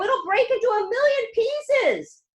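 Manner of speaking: high, loud and slow; panicked shout. A narrator's reading. Grandpa Joe fearing the glass lift will shatter. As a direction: wail it in fear. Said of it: "shouted Grandpa Joe".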